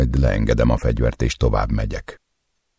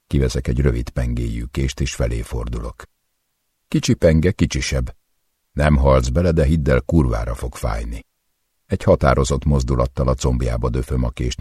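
plain reading voice

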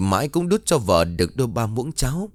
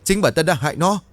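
raised, higher-pitched accusing cry; angry accusation; 7 semitones higher than usual. Acted. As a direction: act out a mother's angry accusation. Mẹ buộc tội trong cơn giận. (giọng buộc tội giận dữ)

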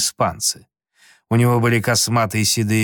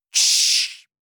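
sharp whispered shush. A narrator's reading. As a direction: hush them with a hiss. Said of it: "произнёс Том". (шиканье шёпотом)

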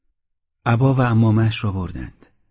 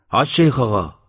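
raised, louder narrated call; 3 semitones higher, 5 dB louder than usual. A narrator's reading. Villager calling through the doorway to the sheikh, loud call. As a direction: call out to get the sheikh's attention. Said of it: "با صدای بلند گفت".

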